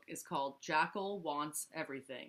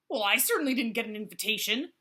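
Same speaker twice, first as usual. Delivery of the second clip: grumbling; 4 semitones higher, 8 dB louder, faster than usual. Acted. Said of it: "grumbled Jackal".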